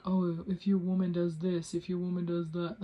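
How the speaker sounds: lightly mocked alpha-male bro; a hint of a smug podcast bro; mild mock alpha lecture. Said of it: "they're like".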